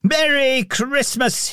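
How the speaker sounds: high, slow festive call. A narrator's reading. Scrooge calls to people in the street, high and slow. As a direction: greet the whole street aloud. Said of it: "he called".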